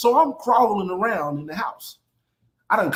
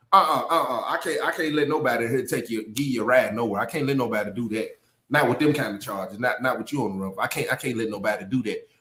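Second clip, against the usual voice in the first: imitating the daddy; 11 semitones lower, 4 dB quieter than usual. Daddy refusing the runaway a ride, low chuckling voice. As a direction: drop way down to play the girlfriend's father.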